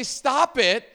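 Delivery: sharp, loud high "Stop it"; parent yelling. Retold or imitated. Parent yelling at the kids to stop fighting, loud. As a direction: snap it at the kids. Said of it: "Like, I'll say".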